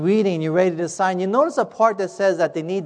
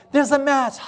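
higher-pitched imitated crowd excitement; erupting excitement; 6 semitones higher than usual. Whole room erupting at the news, imitated excitement. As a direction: echo the news like a thrilled crowd. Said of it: "this erupts in excitement".

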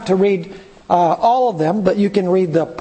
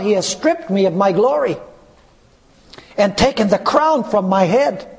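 scripture reading; 4 semitones higher than usual. Acted. Preacher reading Job's cry to the church, solemn. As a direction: read it like holy grief.

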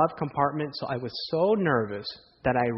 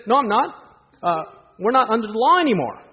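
a touch of a higher, louder know-it-all; mock casual denial, raised pitch; smug excuse; 7 semitones higher, 5 dB louder than usual. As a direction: brush it off as obvious. Man defends taking the ornament.

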